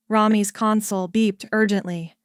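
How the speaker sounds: plain reading voice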